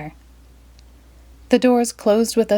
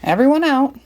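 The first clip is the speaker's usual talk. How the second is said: high, raised call; 7 semitones higher, 3 dB louder than usual. Acted. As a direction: order everyone off. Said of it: "the bus driver called".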